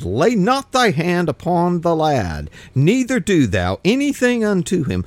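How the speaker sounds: angel's command. Acted. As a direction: call down from heaven to stop him.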